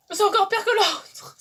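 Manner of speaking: high, loud disgusted wince; sour face. Real, not acted. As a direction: squeal as the acid stings. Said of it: "sour face".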